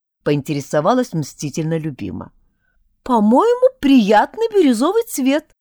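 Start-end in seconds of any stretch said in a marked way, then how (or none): 3.0-5.5 giggling. A narrator's reading. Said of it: "захихикала Тишка".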